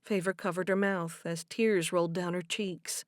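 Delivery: usual speaking voice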